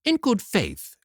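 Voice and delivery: high, eager exclamation; mother suddenly thrilled; excited cry. Acted. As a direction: blurt it out with greed. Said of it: "cried the mother".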